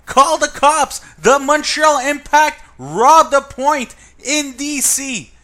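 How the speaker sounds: high, loud panicked screaming; frantic shout. Real, not acted. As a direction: yell like the team just stole a win. Panicked fan yelling in clip.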